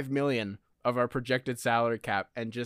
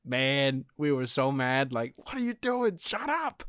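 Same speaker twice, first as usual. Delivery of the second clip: mock fans; 3 semitones higher, slower than usual. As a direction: squeak out the fans' complaint. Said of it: "Pistons fans were like".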